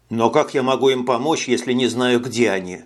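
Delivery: ordinary delivery, nothing marked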